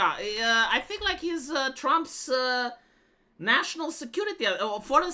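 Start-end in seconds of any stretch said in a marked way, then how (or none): none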